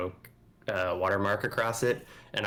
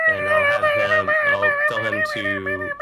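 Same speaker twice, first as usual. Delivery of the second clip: squeaky voice; 9 dB louder than usual. Acted. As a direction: talk to the little robot like a pet. Player squeaks while addressing the robot.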